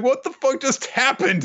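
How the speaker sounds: high-pitched astonished outburst; shocked onlooker. Acted. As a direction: exclaim in disbelief, pitch way up. Retold crowd astonished at teleport.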